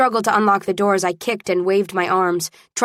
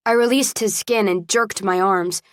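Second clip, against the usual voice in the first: plain reading voice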